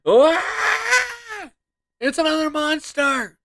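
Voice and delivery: high, loud startled cry at a monster; frightened shout. Acted. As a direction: scream the warning.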